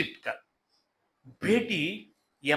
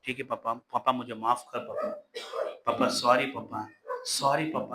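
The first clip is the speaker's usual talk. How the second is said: put-on soft, lower apology like a sorry child; meek pleading son; 7 semitones lower, 3 dB quieter than usual. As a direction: beg father's forgiveness, head down. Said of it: "करके बोलता है ना". (धीमी आवाज़ में माफ़ी मांगता बेटा)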